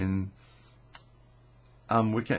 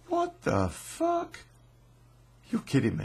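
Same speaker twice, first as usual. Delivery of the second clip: stunned disbelief. Retold or imitated. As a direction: stretch it out, can't believe it. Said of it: "I said".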